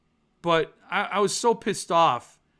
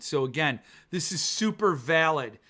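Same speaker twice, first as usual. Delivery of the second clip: usual speaking voice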